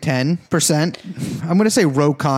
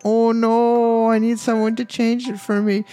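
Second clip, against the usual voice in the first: put-on whiny baby voice; 6 semitones higher than usual. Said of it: "I gotta work on my baby voice".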